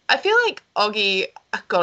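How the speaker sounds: ordinary talk, nothing marked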